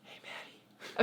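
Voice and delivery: soft, fully voiceless whisper; actual whisper. Shown as a demonstration. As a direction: really whisper the greeting. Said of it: "Okay, now actually whisper".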